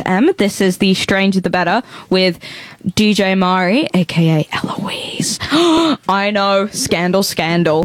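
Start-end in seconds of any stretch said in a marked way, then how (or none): 4.0-5.4 whispered aside. Acted. Secret alter ego aside. 5.4-6.0 sharp theatrical gasp of scandal; mock shocked gasp. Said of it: "Very shocking and surprising".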